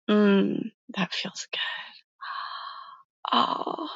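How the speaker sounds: breathy whisper of relief; whispered pleasure. Acted. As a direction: whisper it, melting into the touch. Foot rub, whispered pleasure.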